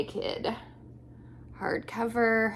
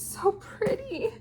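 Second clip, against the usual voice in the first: moved; 13 semitones higher than usual. Real, not acted. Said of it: "it's making me tear up".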